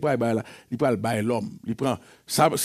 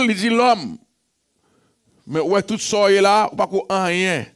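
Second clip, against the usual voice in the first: loud and higher, calling out to the church; fervent preaching; 6 semitones higher, 4 dB louder than usual. Acted. Pastor calling to the beloved, preaching loudly. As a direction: rouse the beloved.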